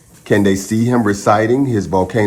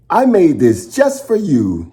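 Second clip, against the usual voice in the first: put-on proud little boy; 9 semitones higher than usual. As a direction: offer the gift eagerly. Milo presents picture to mom.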